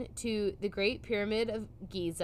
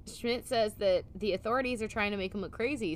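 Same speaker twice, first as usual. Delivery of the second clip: usual speaking voice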